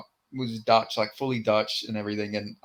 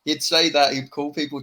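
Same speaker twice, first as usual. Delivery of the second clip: ordinary talk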